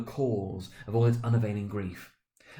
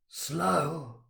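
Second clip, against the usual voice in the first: higher, offended echo of the word; indignant echo; 7 semitones higher than usual. Acted. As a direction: throw the word back, affronted. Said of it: "the ghost repeated".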